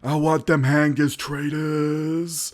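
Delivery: loud, imperious Brando-ish governor. Pretend. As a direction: command the guards like a fading Brando. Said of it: "started as Brando and edged into Tony Curtis".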